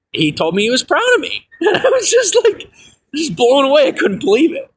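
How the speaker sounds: high voice breaking into laughter; overjoyed amazement. Real, not acted. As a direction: laugh through it, blown away. Overjoyed telling of father's pride.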